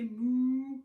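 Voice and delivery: loud mooing cow. Shown as a demonstration. Teacher showing kids how the cow says moo, loud. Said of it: "You say".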